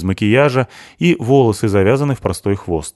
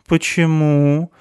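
high, drawn-out puzzled question; surprised; 9 semitones higher, much slower than usual. A narrator's reading. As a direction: stretch it out in surprise. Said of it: "удивлённо спросила Туори". (удивлённо, нараспев)

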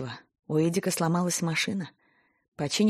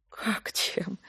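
whisper; 7 dB quieter than usual. Acted. Valentina surprised, whispered.

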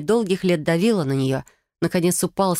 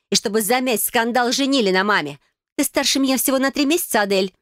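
raised, tense voice telling a secret; bitter sister; 6 semitones higher than usual. Acted. Sister revealing their shared father to Adele, bitter. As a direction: tell it as an accusation, pitched up.

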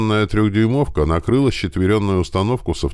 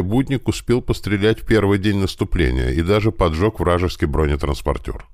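usual speaking voice

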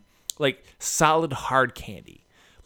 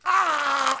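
mock sugar-shock yelp; 14 semitones higher than usual. Mimics sugar hitting the tongue.

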